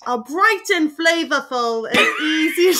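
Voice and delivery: hammed-up British accent read slowly, cracking into laughter; terrible British accent reading the can; bad posh British reading of the label. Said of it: "this horrible British accent".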